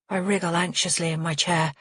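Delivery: ordinary delivery, nothing marked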